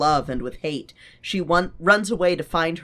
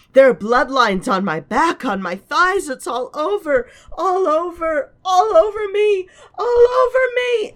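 building to sobbing, higher and louder; rising anguish; 7 dB louder than usual. Acted. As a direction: climb from distress into sobbing. Character's nightmare climax.

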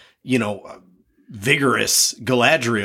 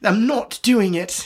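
stubborn refusal; 10 semitones higher, slower than usual. Pretend. Galadriel refusing the elves.

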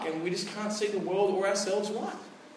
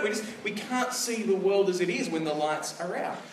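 usual speaking voice

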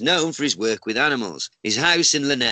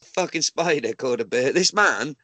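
usual speaking voice

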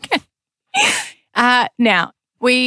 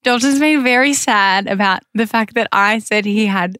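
normal talking voice

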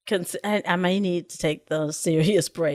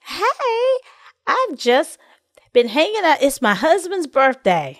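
mock cheerful poster; 8 semitones higher, 4 dB louder than usual. Mocking an overposting Facebook user.

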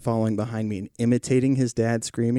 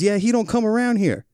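neighbor's retort; 10 semitones higher than usual. Retold or imitated. Retelling the neighbor cutting him off at the door, dismissive. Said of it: "and he goes".

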